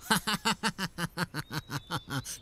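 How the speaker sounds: high, derisive evil laugh; scornful laugh. Acted. Townsman mocks the fear of God, high derisive laugh. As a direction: laugh in his face.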